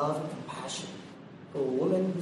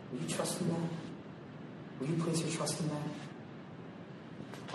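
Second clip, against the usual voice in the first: much softer, slow and intimate; soft slow appeal; 10 dB quieter, much slower than usual. Real, not acted. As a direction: draw them in quietly.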